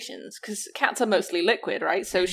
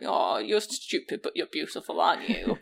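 cooing at the cat; 4 semitones lower, 5 dB quieter than usual. Retold or imitated. Owner telling her cat he's stupid, softly cooing. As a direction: coo it sweetly like praise.

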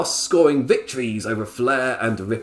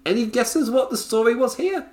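mock quiz; 12 semitones higher than usual. Teasing rhetorical question to audience.

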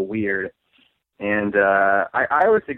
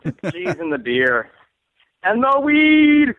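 slow, hammed-up Jamaican accent, last words shot high and loud; a cheesy fake Jamaican accent; mock Jamaican accent like an old beer ad; horrible Jamaican accent; 8 semitones higher, 6 dB louder, slower than usual. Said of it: "horrible Jamaican, Irish, Wisconsin accent".

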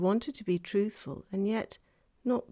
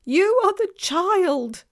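high, loud, slow wail; anguished revelation; 12 semitones higher, 11 dB louder, slower than usual. A narrator's reading. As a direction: wail it slowly as the guilty faun.

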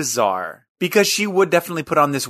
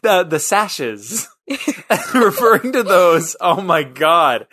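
giggling through the words, voice pitched up high; cracking up at her own slip; laughing; 7 semitones higher, 4 dB louder, slower than usual. Real, not acted. To her co-host about the sausage slip, laughing.

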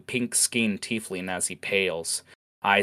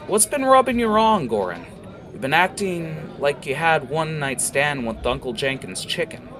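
high, loud needling jab; mocking tiefling; 8 semitones higher, 5 dB louder than usual. Jackal ribbing Goran about his odd mood, high and loud. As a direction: rib the orc mercilessly.

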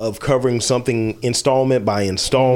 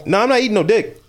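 emphatic refusal; 8 semitones higher than usual. Real, not acted. Refusing the gross dare.

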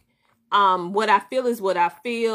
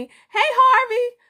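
high, louder called hey Harvey; excited greeting; 6 dB louder than usual. Real, not acted. Calling out to viewer Harvey, high and excited. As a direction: call out to a friend happily.

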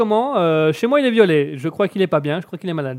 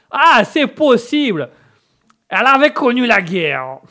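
put-on slow, higher American-accented French; 5 semitones higher, 4 dB louder, slower than usual. Coach admitting a German great-aunt, drawn-out American accent. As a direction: muse aloud as the drawling American. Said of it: "l'Américain a un petit chat dans la gorge".

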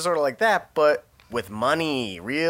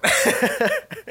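high squeal laugh. Real, not acted. Falsetto laugh at bluff.

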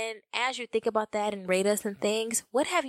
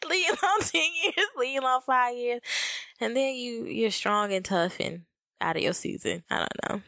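ordinary talk, nothing marked

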